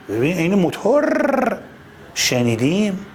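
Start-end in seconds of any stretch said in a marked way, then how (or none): none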